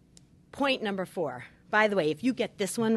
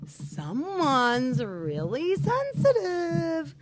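high, slow, sing-song taunt; sarcastic whiny jab; mocking sarcasm; 8 semitones higher, 5 dB louder, much slower than usual. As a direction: sneer at a spouse's feelings. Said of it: "instead of when your spouse gets upset".

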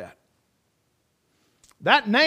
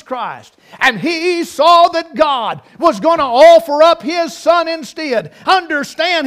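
high, loud whooping preacher cadence; preaching at full force; 8 semitones higher, 10 dB louder, slower than usual. Real, not acted. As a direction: build the fervour of the sermon.

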